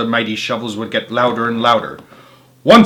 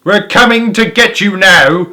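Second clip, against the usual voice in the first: higher, louder mocking hail; taunting yell; 7 semitones higher, 7 dB louder than usual. A narrator's reading. Bunce yells at Mister Fox from his tractor, higher and louder. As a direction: jeer down the hole. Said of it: "yelled Bunce".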